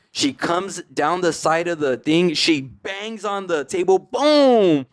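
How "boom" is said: imitated bang. Aunt bangs on the table.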